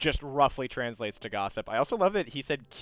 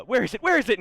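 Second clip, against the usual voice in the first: high, loud, frantic character voice; acted eager demand; 10 semitones higher, 7 dB louder, faster than usual. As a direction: demand it with mounting excitement. Foji eagerly searching Ichiro.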